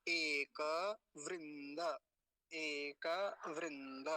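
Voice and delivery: e-ka vrin-da, each syllable of एक वृंद held; model pronunciation. Shown as a demonstration. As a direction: say एक वृंद slowly with both final a vowels. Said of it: "फिर से सुनिए".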